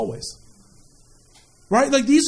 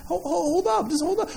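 higher, softer stammered plea to wait; imitated "hang on" with a stutter; patient stalling; 6 semitones higher, 5 dB quieter than usual. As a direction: gently fend off a pest. God putting off impatient askers.

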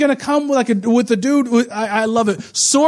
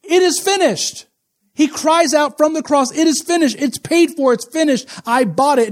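crying out; 6 semitones higher than usual. Retold or imitated. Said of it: "Jesus on the Christ cries out".

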